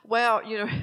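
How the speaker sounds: lightly imitated friend pleading an excuse; a hint of a friend's excuse-making voice. Best friend excusing herself to the preacher, lightly imitated. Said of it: "But she'd say".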